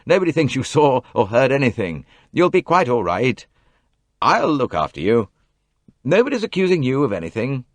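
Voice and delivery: harsh. Acted. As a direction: cut her off, harsh and loud. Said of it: "said Simon harshly".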